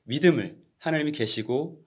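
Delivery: ordinary talk, nothing marked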